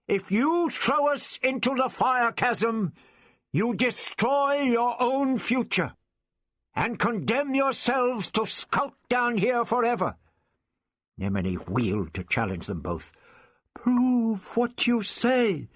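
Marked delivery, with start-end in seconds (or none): 0.1-10.2 shout. A narrator's reading. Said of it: "Ian shouted". 13.7-15.7 high, deliberate challenge; slow demand. A narrator's reading. Nemini challenges Vrestin and Ian, slow and demanding. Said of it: "he demanded".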